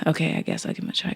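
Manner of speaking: soft breathy mutter of giving in; resigned mumble. Retold or imitated. Giving in to Mom's advice, in a soft reluctant mutter. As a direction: barely voice it, reluctantly agreeing.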